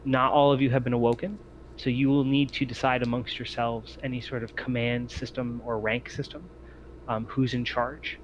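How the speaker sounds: usual speaking voice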